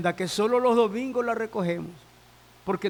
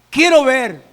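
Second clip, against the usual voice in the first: loud, emphatic plea; insistent plea; 4 semitones higher, 10 dB louder than usual. Acted. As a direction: burst out with the request. Blind man repeats his plea emphatically.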